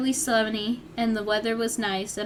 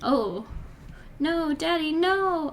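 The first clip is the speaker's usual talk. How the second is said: rising, mildly hammed-up wail of no; mock scream; 6 semitones higher, slower than usual. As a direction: cry out as the dad is hit, voice climbing. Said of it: "I screamed".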